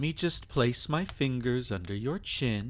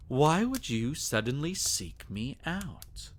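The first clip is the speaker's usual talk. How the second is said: normal talking voice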